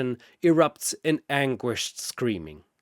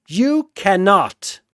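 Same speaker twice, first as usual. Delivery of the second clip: slow, drawn-out shout, higher and louder; mock villain shout; 6 semitones higher, 7 dB louder, slower than usual. Sephiroth shouting down at the heroes, slow and loud. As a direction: bellow it as the villain.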